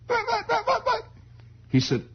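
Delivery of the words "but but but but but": very high stammer; sputtering kid. Retold or imitated. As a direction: sputter in panic.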